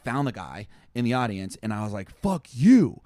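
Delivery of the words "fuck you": angry yell. Retold or imitated. Yelling at heckler from stage.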